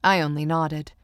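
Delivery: ordinary delivery, nothing marked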